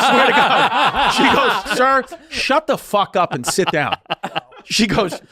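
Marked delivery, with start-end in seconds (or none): 1.8-4.0 slow, emphatic mock telling-off; retold angry order. Flight attendant finally snapping at passenger, mock angry order. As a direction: imitate her laying down the law, higher-pitched. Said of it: "she finally goes".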